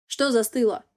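gruff barbarian voice; rough question. A narrator's reading. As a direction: bark the question at her. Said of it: "Раздаётся грубый вопрос". (грубый вопрос варвара)

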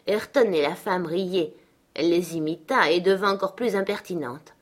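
plain reading voice

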